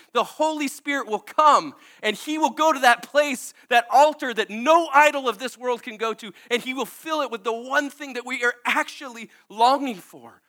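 high, loud preaching climax; fervent preaching. Acted. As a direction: build it like a sermon peak.

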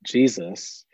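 normal talking voice

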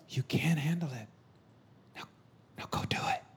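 whispered encouragement; intense, breathy whisper as God; hushed heavenly voice. Retold or imitated. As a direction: urge them on in a whisper. Voices heavenly Father in spirit.

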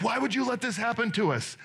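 put-upon wail, only lightly acted; mock weeping. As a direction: imitate a tearful complaint without real crying. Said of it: "they're weeping, they're crying".

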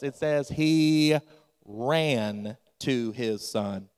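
It says he ran to his son, very slowly, stress on ran; slow emphatic correction. Shown as a demonstration. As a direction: dwell on ran to set it right. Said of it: "No, that's not what it says".

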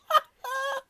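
high loud witch cackle. Shown as a demonstration. Party trick for the podcast, loud witch cackle. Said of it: "that's my other party trick".

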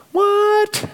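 high, startled squeak of disbelief; dad's high squeak of disbelief; stunned. Real, not acted. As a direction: pop the word up in shock. Astonished she chose him.

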